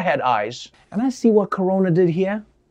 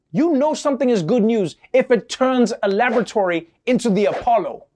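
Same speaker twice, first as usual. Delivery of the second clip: ordinary talk, nothing marked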